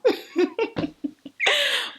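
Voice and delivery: high, bursting real laugh; burst of laughter. Real, not acted. Laughs after cheeky remark.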